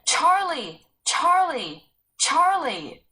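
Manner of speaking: loud, high calls with a falling end; calling out. Acted. Sarah calling her lost brother across the valley, loud calls. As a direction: call his name across the valley. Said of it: "she called".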